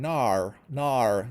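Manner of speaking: imitating an Australian "no"; mock Australian accent. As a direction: stretch "no" into a teasing Aussie "naur". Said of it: "making fun of your accent".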